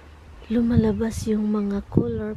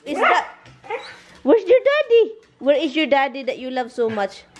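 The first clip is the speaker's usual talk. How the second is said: high, louder gushing at the dog; excited pet talk; 3 semitones higher, 5 dB louder than usual. Real, not acted. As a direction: hype the dog up about Daddy.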